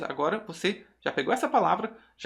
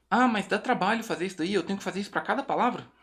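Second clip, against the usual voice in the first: higher-pitched, hurried imitation of a reluctant learner; mock student objection; 4 semitones higher than usual. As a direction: lightly act out a student's protest.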